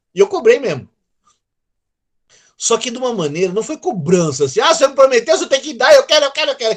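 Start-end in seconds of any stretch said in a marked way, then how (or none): 4.6-6.8 put-on high, loud childish demanding; like a little child. Preacher mocking his own prayer, whining like a child. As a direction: whine it like a child wanting a toy. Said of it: "que nem criancinha".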